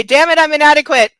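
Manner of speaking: half-hearted dramatic lament; quoting C J. Retold or imitated. Tired reader quoting C J with mock despair. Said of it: "To quote C J".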